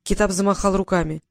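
ordinary delivery, nothing marked

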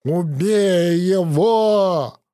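slow wheeze gurgling through blood; bloody mouth. Acted. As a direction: rasp it out choking on blood. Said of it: "булькая кровью, просипел Петя".

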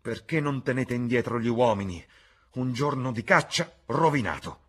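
loud, high-pitched complaint; irritated. A narrator's reading. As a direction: scold them angrily across the field. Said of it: "rispose irritato Sir Geoffrey".

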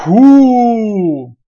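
very loud wolf blowing; huge blow. Acted. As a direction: blow with full force. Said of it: "дунул во второй раз".

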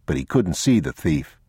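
ordinary delivery

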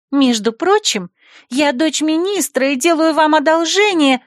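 put-on high, loud, haughty girl. Minister's daughter lectures the rector, high and haughty. Lulu's voice.

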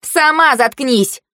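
high, slow, drawn-out retort; quarrelling retort. A narrator's reading. Girl answering her rival's insult, high drawn-out retort. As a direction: throw it back, stretched and high.

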